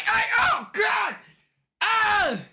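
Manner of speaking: high startled yelp; startled shriek. Real, not acted. Startled by the dog jumping on her, a high shriek.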